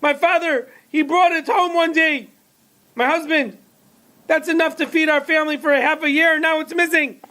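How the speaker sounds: high distressed mother explaining to storekeeper; distraught mother. A narrator's reading. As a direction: lay out her loss in a high frantic voice. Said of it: "She said".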